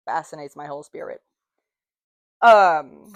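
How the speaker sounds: ordinary talk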